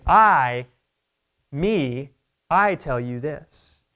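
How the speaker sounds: "I" punched very loud, then "me" set apart by pauses. Retold or imitated. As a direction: hammer "I", pause, then slowly assert "I tell you this". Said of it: "it's even more emphatic".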